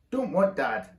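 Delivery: put-on loud baby bellow. A narrator's reading. Baby refusing to go to dad, bellowing. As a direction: bellow it like a cross toddler. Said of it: "bellowed the baby".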